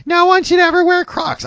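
high, haughty mimic of a teen; mocking daughter voice. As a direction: squeak it out like a snooty teen. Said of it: "she's, she's, uh, like".

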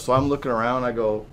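normal talking voice

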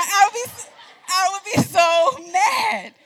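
high, loud, drawn-out exasperated shout with a laugh; hammed-up yell of being mad; mock furious outburst. Acting out her past anger.

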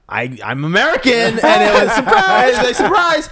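high, loud, laughing mock announcement; mock surprise reveal. Imitating actress revealing her real nationality, gleeful mock surprise. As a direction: burst out with a hammed-up "surprise".

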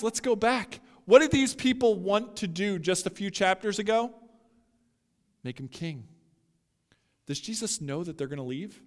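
usual speaking voice

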